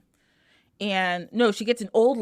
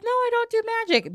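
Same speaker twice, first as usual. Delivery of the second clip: fake innocent denial; 13 semitones higher, faster than usual.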